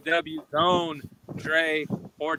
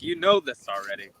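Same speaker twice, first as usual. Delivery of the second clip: ordinary delivery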